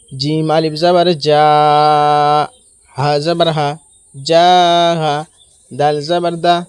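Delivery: যা held long, হা দা short; only first syllable long. Shown as a demonstration. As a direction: draw out যা, then two quick syllables হা দা. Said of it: "জিম আলিফ জাবার যাই শুধু টান হবে".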